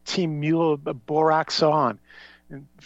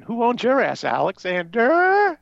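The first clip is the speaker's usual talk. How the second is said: higher, drawn-out teasing crow; gleeful mock echo of a nagging wife; mocking taunt; 8 semitones higher, 4 dB louder, slower than usual. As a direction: stretch the name into a jeering call. Teasing echo of the wife's call.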